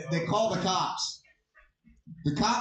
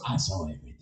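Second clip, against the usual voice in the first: very low, quieter character voice; manager witness; 4 dB quieter, much faster than usual. Retold or imitated. McDonald's manager stepping up as witness, in a deep put-on voice. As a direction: drop deep for a mock-grave witness.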